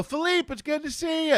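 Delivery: high, bright crew greeting; cheery greeting. Retold or imitated.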